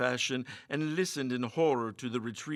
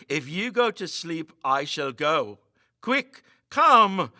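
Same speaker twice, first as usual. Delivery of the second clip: strident; 3 semitones higher, 8 dB louder, slower than usual. A narrator's reading. Meagle pleading with Barnes to leave, strident. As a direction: drive him out the door. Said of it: "in strident tones".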